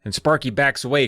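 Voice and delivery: usual speaking voice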